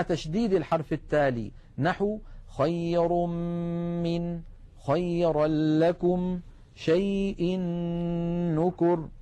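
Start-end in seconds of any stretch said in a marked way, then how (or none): 2.6-9.1 tajweed chant on level held notes, merging the tanween fully into the next letter; slow Quranic example, doubled consonants held; full idgham recitation. Shown as a demonstration. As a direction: recite خير من with the tanween melted into a long, hummed م. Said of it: "علامة الإدغام الكامل".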